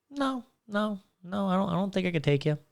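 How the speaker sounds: high-pitched nos, easing down; mock meek denial. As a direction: playfully act out backing down from a challenge. Retold reply backing down from drunk challenger.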